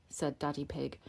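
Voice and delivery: plain reading voice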